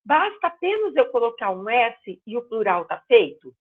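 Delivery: normal talking voice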